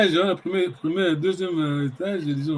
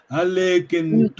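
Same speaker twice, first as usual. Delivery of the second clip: slow reading; slower than usual. Shown as a demonstration. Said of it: "Est-ce que vous avez compris".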